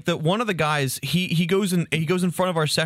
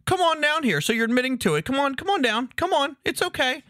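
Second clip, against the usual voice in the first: a much higher, wheedling mock cop voice; mock cop coaxing; 10 semitones higher than usual. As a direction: coax him down like a smug small-town cop. Cop coaxing fan from stands.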